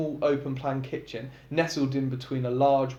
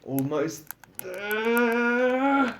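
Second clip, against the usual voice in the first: growling; 8 semitones higher, much slower than usual. A narrator's reading. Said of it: "He growls".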